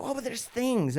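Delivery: high-pitched worried objection; panicky woman. Retold or imitated. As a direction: imitate a frightened swimmer protesting. Voices the frightened woman.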